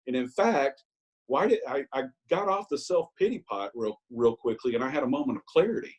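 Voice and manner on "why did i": usual speaking voice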